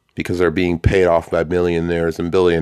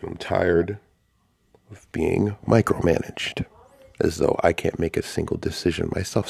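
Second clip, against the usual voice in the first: ordinary delivery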